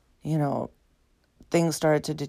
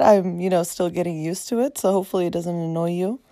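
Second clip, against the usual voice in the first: normal talking voice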